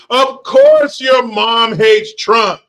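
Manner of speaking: loud, high, drawn-out jeer; mocking exclamation. Real, not acted. Streamer jeers back at a commenter, loud mocking exclamation. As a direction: crow it slowly, every word punched high.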